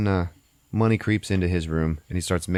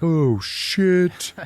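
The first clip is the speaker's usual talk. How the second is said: high, drawn-out "oh shit"; movie-watcher yelling at the screen; loud, high-pitched outburst of alarm; alarmed exclamation; 10 semitones higher, 4 dB louder than usual. Retold or imitated. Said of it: "I was talking out loud again".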